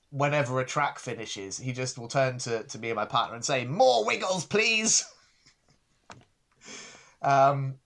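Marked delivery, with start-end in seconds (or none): 3.6-5.0 put-on high, loud little-kid plea; toddler demanding. Parent imitating toddler begging for more Wiggles. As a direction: beg like an eager toddler.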